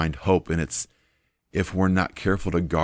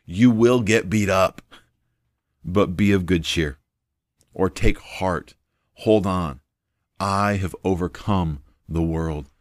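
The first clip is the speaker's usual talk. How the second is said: preaching Jesus' words; 3 dB louder, slower than usual. Acted. Preacher voicing Jesus' promise.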